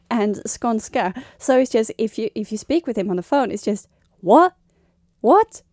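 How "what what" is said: escalating mock bafflement, higher and louder each time; baffled asking. Niece on the phone with uncle, increasingly baffled. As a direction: repeat it in rising, loud incomprehension.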